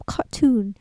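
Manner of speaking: ordinary talk, nothing marked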